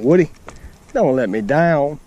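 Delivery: normal talking voice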